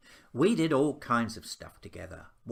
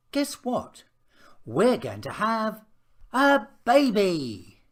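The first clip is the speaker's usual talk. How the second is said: high, thrilled reveal, drawn out at the end; a slow, beaming stretch on a baby; excited announcement; 10 semitones higher, 9 dB louder, slower than usual. A narrator's reading. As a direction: break the big news with delight. Parents announce the new baby.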